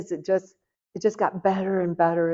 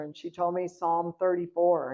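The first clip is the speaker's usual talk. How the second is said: ordinary talk, nothing marked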